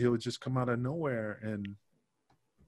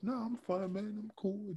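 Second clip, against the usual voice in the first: very soft, higher-pitched imitation of a laid-back friend; mellow; 8 semitones higher, 10 dB quieter than usual. Wrestler brushing off a friend's concern, mellow and hushed. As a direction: brush off concern softly and gently. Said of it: "like, mellow".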